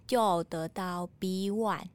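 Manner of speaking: normal talking voice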